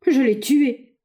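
high-pitched, anguished exclamation; despairing cry. A narrator's reading. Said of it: "s'écriait le vieillard avec désespoir".

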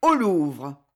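understated narrated cry. A narrator's reading. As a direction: send the crowd onward. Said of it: "cria Condé".

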